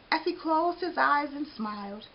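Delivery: usual speaking voice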